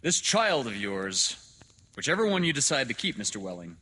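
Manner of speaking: loud, high, oratorical address; declaiming doctor. Acted. Doctor addressing father about his child, loudly oratorical. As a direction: proclaim it loudly, rushing the aside. Dr. Hitz, a figure in the story.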